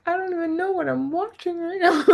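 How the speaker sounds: high, fast, baffled aside; mock bewildered. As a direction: rattle it off in disbelief. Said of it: "I'm like".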